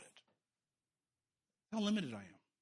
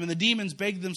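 usual speaking voice

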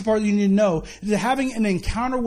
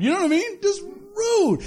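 high, indignant just rude; mock the outrage at being ignored; 8 semitones higher than usual. Son's indignation at rude neighbors.

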